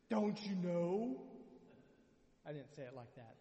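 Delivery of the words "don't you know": slow, loud, exasperated "don't you know"; played-up indignation. Pastor hamming up outrage at the villagers. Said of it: "I just gotta play it up a little bit".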